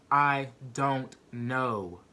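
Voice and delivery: drawn out word by word; slow, emphatic answer. Retold or imitated. Answering jail staff asking why she's here, slow and emphatic. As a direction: spell it out very slowly. Retelling her answer on being questioned.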